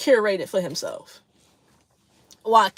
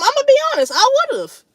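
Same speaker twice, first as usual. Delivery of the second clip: higher, louder honest confession; an earnest owning-up, pitched up and punched out; emphatic admission; 5 dB louder, faster than usual. Real, not acted. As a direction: blurt it out, much higher and louder. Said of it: "look, I'm".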